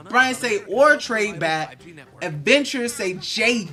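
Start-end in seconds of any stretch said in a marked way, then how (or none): none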